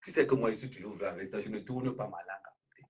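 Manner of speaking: ordinary talk, nothing marked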